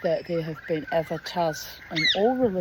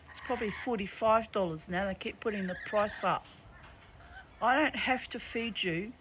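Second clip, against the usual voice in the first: talking to birds; 5 semitones higher than usual. Real, not acted.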